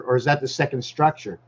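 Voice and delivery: normal talking voice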